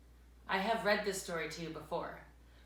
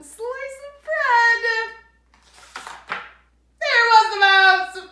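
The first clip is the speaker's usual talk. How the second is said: dramatic surprise; 17 dB louder than usual. A narrator's reading. Narrator building surprise.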